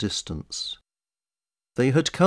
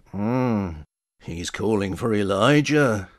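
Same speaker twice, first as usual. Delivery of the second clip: musing bystander; 3 semitones lower, slower than usual. A narrator's reading. Bystander's puzzled remark in the story.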